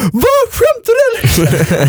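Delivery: very high, stretched-out shriek of disbelief; retold outraged disbelief. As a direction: imitate someone shocked awake, squealing in protest. Voices woken woman's outrage. (återberättat gällt, upprört utrop)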